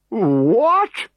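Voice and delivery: high, loud outraged roar; furious high bellow. A narrator's reading. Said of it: "bellowed Vital Statistics".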